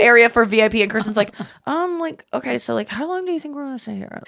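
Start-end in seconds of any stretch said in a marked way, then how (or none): 1.7-4.2 hushed friend. Retold or imitated. Mimicking friend's hushed question.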